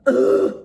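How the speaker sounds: loud exasperated groan of disgust; frustrated ugh. Real, not acted. Venting to viewers about the caller, loud groan. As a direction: let out a loud fed-up ugh. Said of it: "I'm so fucking mad".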